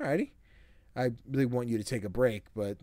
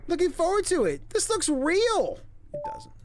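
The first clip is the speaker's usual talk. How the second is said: very high, loud hype; excited squeal; 5 dB louder than usual. Real, not acted. Streamer hyping the game's start with a high squeal. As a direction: squeal with excitement over the game.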